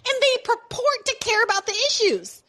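sarcastic high-pitched liberal impression; mock liberal voice. Mocking liberal reasons against Bernie.

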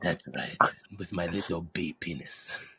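put-on very low, soft, slow Spanish accent; Spanish-accented bee. Cartoon bee adding a detail, hushed Spanish accent. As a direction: murmur it in a deep Spanish accent. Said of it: "getting out of Spanish now".

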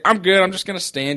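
high, bright fake-casual I'm good; mock breezy cover-up. To the family offering him a seat, a high fake-casual brush-off. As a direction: brush off the offer to sit, forced cheer. Hiding his pain from family.

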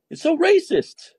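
very high-pitched, drawn-out complaint; mock indignation. Host mimicking critics crying racism, mock indignation. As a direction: whine it slowly, mocking the offended.

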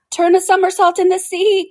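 high, loud turtle cry; excited cry. A narrator's reading. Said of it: "cried the Mock Turtle".

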